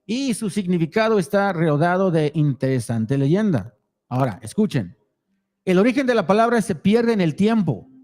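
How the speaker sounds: reading the Spanish text aloud; steady Spanish read-through; reading foreign language. Real, not acted. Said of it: "read it in Spanish".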